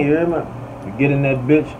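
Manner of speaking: ordinary talk, nothing marked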